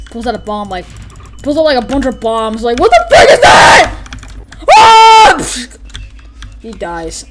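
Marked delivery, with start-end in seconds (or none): 2.8-3.9 high, loud panicked yell; alarmed mock shout. Player voicing a victim spotting a pile of bombs, panicked shout. 4.7-5.4 high, loud scream; pretend death scream. Player voicing a bomb victim, short high scream.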